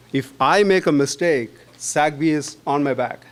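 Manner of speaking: ordinary talk, nothing marked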